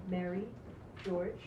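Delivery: ordinary delivery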